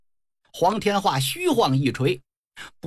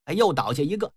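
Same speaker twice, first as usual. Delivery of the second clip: ordinary delivery, nothing marked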